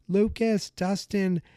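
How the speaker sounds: high-pitched, half-hearted scream for help; mock scream. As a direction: imitate calling out for friends without real volume. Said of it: "She's screaming for".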